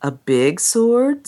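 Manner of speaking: drawn out slowly, as if thinking hard; a child weighing the answer, very slowly; slow, pondering child. Retold or imitated. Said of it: "said the child after a pause for deep cogitation".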